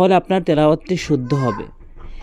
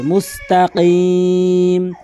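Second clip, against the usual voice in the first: ordinary talk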